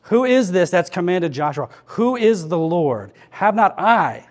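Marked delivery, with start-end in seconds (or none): 3.3-4.2 "I" raised and held before a pause; emphatic, drawn-out "I"; stress on I. Shown as a demonstration. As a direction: land firmly on a higher "I". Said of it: "The emphasis I see here is I".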